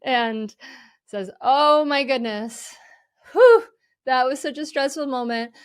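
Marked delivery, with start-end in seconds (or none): 1.4-5.5 louder, higher, exhaling exclamation; acted stressed relief. Parent catching themselves after yelling, acted flustered relief. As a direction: play a parent letting out the stress. Acts parent realizing they overreacted.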